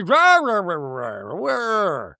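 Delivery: wordless "rah-rah" imitation of a retort; mock growling gibberish. Mocks Meredith's reply as noise.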